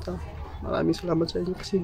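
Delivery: usual speaking voice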